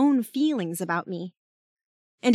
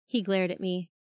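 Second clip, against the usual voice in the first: plain reading voice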